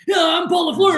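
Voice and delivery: high, loud hammed-up shout; over-the-top mimicry of an excited caller; mock mirroring. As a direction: parody copying the caller's tone. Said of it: "I'm not gonna start saying".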